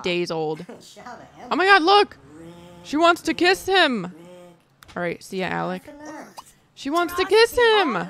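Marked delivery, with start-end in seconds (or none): 1.5-2.2 high, loud burst of delight; sudden loud excited cry; excited squeal. Real, not acted. Sees Sims about to kiss. 2.8-4.1 high and loud with delight; excited gushing. Real, not acted. Sees Sims about to kiss. 6.7-8.0 excited. Real, not acted. Repeats with more excitement.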